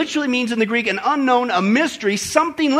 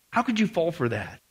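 "How could you fall for that?" lower and softer, shaking your head; quiet letdown repeat; 5 semitones lower, 6 dB quieter than usual. Acted. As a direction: mutter the rebuke low. Repeat of rebuke, dropped low.

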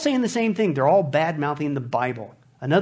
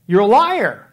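loud, emphatic accusation; loud rebuke; 2 semitones higher, 9 dB louder, slower than usual. Acted. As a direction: fling the charge out loudly at the deceiver. Rebuking the so-called aliens after scripture.

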